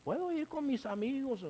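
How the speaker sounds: mock child asking permission; pleading teen. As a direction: plead with the parent like a hopeful teen. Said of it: "cuando le dicen".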